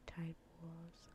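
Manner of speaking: normal talking voice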